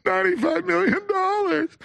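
high, slow mock-weepy whine; hammed-up teary gasp; mock crying. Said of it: "The jury starts crying".

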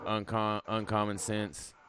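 ordinary delivery

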